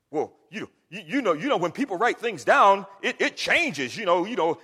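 loud, blustering objection; mocked skeptic. Preacher imitating doubters of scripture, loud blustering protest. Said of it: "they say".

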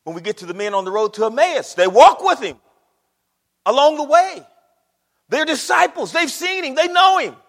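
loud, rising sermon delivery; high, emphatic preacher's proclamation; full-force preaching. Real, not acted. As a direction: punch it out to the congregation.

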